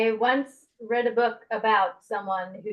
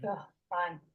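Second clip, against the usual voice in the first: begrudging; 7 dB quieter than usual. Acted. Reluctant character following friends who are all climbing up, begrudging. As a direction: agree reluctantly with a grunt of distaste. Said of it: "but begrudgingly".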